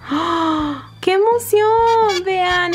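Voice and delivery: high, slow thrilled exclamation; excited squeal. Real, not acted. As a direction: burst out with joy. Opening a toy.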